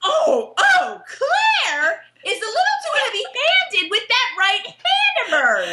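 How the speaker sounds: high, hammed-up scandalised protest; mock outrage. Fan complains to co-host about the slap, high mock outrage. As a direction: cry out in mock shock, pitched high.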